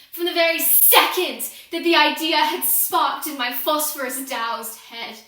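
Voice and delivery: sudden full-force shout, pitch leaping up; angry outburst. Acted. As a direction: explode in accusation at God. Accuses God in confession monologue.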